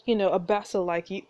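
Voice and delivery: ordinary talk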